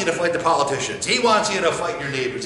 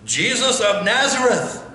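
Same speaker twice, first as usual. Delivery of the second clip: demon's cry; 7 semitones higher than usual. Acted. Demon names Jesus.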